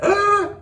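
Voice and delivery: a sharp, high, hammed-up gasp for air; dramatic gasp. Story hero out of breath, hammed-up high gasp. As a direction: gasp like you've run out of breath.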